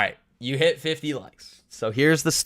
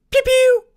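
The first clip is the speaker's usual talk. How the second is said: high, loud "pew pew"; mock gunfire; 8 dB louder than usual. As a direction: imitate toy-like gunshots with your voice. Imitates gunshots in the story.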